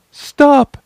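high, loud command; cried out. A narrator's reading. Stopping the closing sailors, a high loud cry. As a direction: cry it out to halt the circle. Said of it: "she cried".

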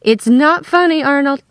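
high, loud, exasperated reproach; indignant scolding. A narrator's reading. Wife scolds husband for joking, high and indignant. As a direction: scold him for making light of it.

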